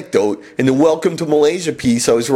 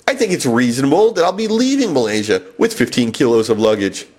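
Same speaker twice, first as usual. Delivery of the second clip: ordinary delivery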